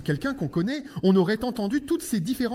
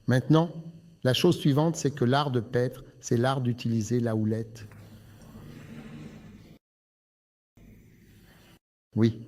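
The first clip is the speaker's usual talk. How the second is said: lower, measured sermon voice; preaching; 10 semitones lower than usual. Acted. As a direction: preach with weight.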